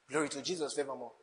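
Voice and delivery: ordinary talk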